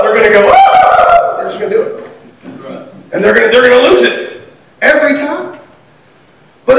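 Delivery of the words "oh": put-on the kids' copy of "Oh," shouted very high through a laugh; mimicked exasperated cry. Kids copying their parent's frustration, high laughing mock cry. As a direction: echo the parent's wail, laughing.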